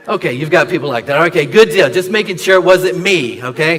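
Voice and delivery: laughing while checking with viewers; laughing at audience. Real, not acted.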